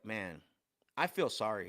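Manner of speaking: low, grave delivery of a coach's disappointment; really serious. Retold or imitated. Coach pitying a trainee before the class, really serious. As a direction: imitate a mentor's sober reproach. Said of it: "he's really serious".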